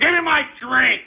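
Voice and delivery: high, insistent outburst; exasperated demand. Real, not acted. Host calling for his drink, exasperated.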